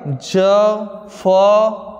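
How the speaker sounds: ज and फ held long, one at a time; letter demonstration. Shown as a demonstration. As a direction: sound out ज, then फ, slowly and separately. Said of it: "आगत व्यंजन यानी फारसी व्यंजन". (अक्षरों का धीमा, अलग-अलग उच्चारण)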